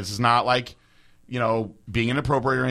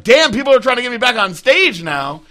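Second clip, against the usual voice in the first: excited exclaim; 12 semitones higher, 9 dB louder than usual. Retold or imitated. Retold own surprised reaction.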